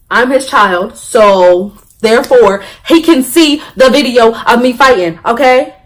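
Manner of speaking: loud, high, word-by-word demand; insistent argument. Retold or imitated. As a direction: lay it down forcefully, as in a retold argument. Retold argument with school, building force.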